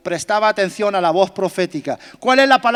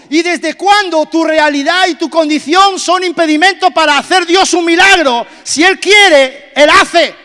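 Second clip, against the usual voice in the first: preaching at full force; 5 semitones higher, 8 dB louder than usual. Acted. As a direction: build to a shouted climax. Preaching climax. (predicación a toda voz)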